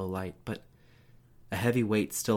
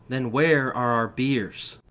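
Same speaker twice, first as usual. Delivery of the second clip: angry father; 3 semitones higher, 6 dB louder, slower than usual. A narrator's reading. Dad demanding beers.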